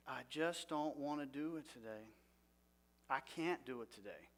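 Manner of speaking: soft, defeated murmur; voicing the thought of a heavy morning, quiet and tired; weary inner voice. Preacher voicing a depressed thought, soft and weary.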